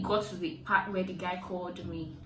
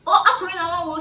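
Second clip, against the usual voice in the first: mocking the women; 9 semitones higher, 9 dB louder, much faster than usual.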